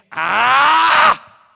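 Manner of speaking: loud, irritated yell at being interrupted; turning round, shouting in annoyance; angry shout. Retold or imitated. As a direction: snap back with a furious shout. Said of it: "me dio rabia, y volteé y le dije".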